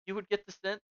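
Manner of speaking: ordinary delivery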